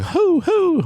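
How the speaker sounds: high owl call imitation; owl hoot. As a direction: hoot twice like a distant owl. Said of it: "owls going".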